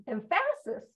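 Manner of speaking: "emphasis" said as em-FASS-is; "emphasis" with a wrongly placed stress on PHA; deliberate mispronunciation. Shown as a demonstration. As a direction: stress the second syllable of "emphasis". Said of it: "I know I'm pronouncing it wrong".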